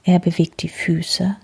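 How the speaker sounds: usual speaking voice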